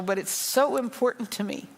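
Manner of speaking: fighting back tears while speaking; real emotion pushing the voice up; emotional. Real, not acted. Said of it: "I'm sorry that I'm so emotional".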